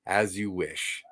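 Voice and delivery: slow and low, gently; tender. Retold or imitated. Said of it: "just looks at him and says".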